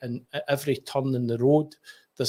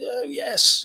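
imitating expat; 11 semitones higher than usual. Expats report back to the teller.

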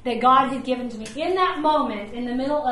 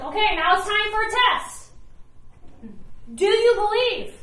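voicing Jesus; 6 semitones higher than usual. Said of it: "He was like".

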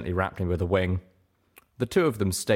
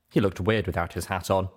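plain reading voice

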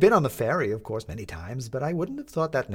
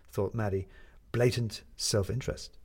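usual speaking voice

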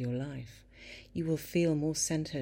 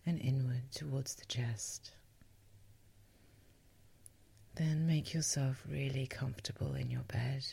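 guided sleep meditation. Acted. Guide helping a listener get to sleep, calm meditation voice. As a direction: lull the listener gently towards sleep.

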